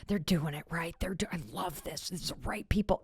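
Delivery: hushed whisper to her son; whispering eagerly at the info session. Retold or imitated. Mother whispering approval to her son at the info session. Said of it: "and I'm like".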